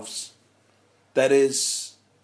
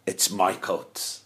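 subconscious; 9 semitones lower, 6 dB quieter, slower than usual. Retold or imitated. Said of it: "I was screaming".

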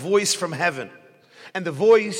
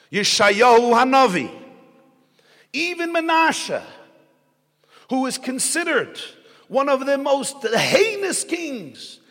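raised, fervent sermon voice; preaching; 5 semitones higher, 3 dB louder than usual. Acted. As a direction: preach it out to the congregation. Preaching at full force.

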